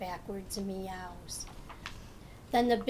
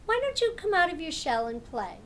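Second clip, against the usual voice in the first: a higher, brighter cat invitation; playful cat; 6 semitones higher, 4 dB louder than usual. Acted. Cat invites turtle to play, eager. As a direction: coax him out to play.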